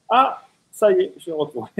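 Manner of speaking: high, loud relieved exclamation; mock relief at finding the bike; relieved rider. Voicing the rider finding his bike.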